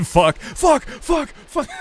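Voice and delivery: high, loud yelped swearing, repeated; mock yelling. Imagining yelling in Walmart for fun, rising mock yell. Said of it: "started yelling".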